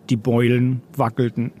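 plain reading voice

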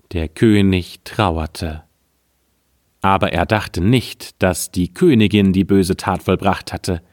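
ordinary delivery, nothing marked

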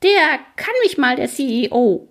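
high, defiant outburst; bellowing ferryman. A narrator's reading. Ferryman curses the boss, high angry outburst. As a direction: blurt it out in a strained high voice. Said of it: "brüllt der Fährmann".

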